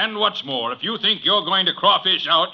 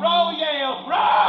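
cheering; 11 semitones higher, slower than usual. Acted. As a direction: roar your crew on. Cheering his crew on.

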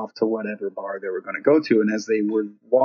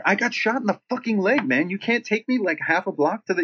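exasperated; 9 semitones higher than usual. Retold or imitated. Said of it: "And Damien's like".

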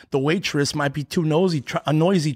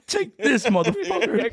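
mock tough guy; 7 semitones higher than usual. Mock revenge with scissors.

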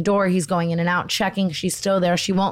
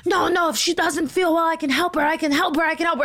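high, pleading imitation of the nurse; mock frantic nurse; 10 semitones higher than usual. Imitating the nurse insisting she can help, high pleading mock voice. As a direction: repeat it insistently in a pitched-up mock voice. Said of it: "And she's like".

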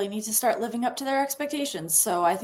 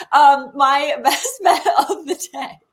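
laughing; 5 semitones higher, 8 dB louder than usual. Real, not acted. Laughing through numb tongue.

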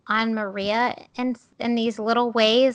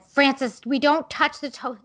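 sharp raised warning, higher and louder; 5 semitones higher, 3 dB louder than usual. Retold or imitated. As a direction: snap a warning at a toddler, loud and high. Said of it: "So I raised my voice and I said".

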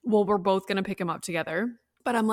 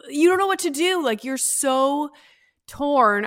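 torn; 7 semitones higher, 4 dB louder than usual. Retold or imitated. Mother torn leaving crying child.

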